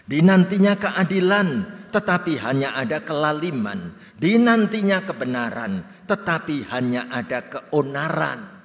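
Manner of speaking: loud, steady reading of scripture together; loud communal scripture reading. Acted. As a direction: declaim the verses in unison. Congregation reads scripture aloud.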